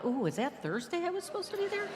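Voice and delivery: hammed-up scatterbrained surprise; mock dismay. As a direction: play suddenly remembering a forgotten date. Acting out forgetful yellow double-booking.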